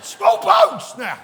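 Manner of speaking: very high, slow, full-force yell; worked-up shout. Pretend. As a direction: stretch out each word at top volume. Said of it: "I've set myself off".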